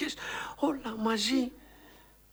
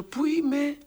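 a panicked shout, higher and louder; 5 semitones higher, 4 dB louder, slower than usual. Acted. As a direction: cry out, lost and scared. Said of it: "Άρχισα να φωνάζω". (πανικόβλητη φωνή)

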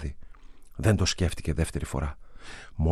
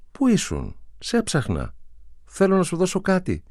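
meaningfully; 12 semitones higher than usual. A narrator's reading. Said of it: "του είπε όλο νόημα".

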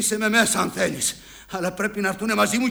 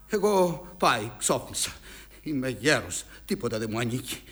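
low, soft admission of defeat; weary resignation; 8 semitones lower, 8 dB quieter than usual. Acted. Old father telling his son he is finished, weary resignation. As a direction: give up, voice dropping low and quiet.

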